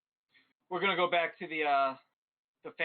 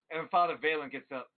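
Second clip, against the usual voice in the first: normal talking voice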